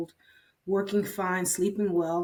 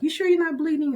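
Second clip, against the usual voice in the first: higher and louder probing question; pressing doubt; 9 semitones higher, 6 dB louder, faster than usual. Retold or imitated. Doctor asks her nurse again about bleeding, higher and louder. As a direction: push her once more.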